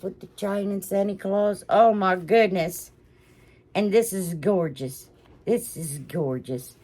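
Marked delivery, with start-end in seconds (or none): none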